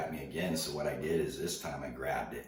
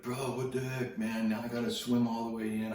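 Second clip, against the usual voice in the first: much higher, louder whiny complaint; whiny kid; 8 semitones higher, 5 dB louder than usual. Retold or imitated. Surfer mimicking the kid whining about his lost board, much higher and louder. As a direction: whine like a kid who has to swim in.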